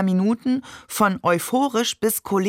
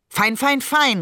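exasperated higher-pitched call; raised-voice outburst; 6 semitones higher than usual. A narrator's reading. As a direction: call it out as a quick, high-pitched string. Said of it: "rief Barbe".

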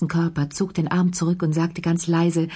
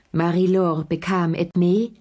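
plain reading voice

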